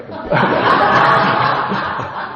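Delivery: loud breathy laugh at his own joke; amused laugh after a joke; burst of laughter. Real, not acted. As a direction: crack up at the slip.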